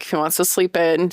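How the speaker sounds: ordinary delivery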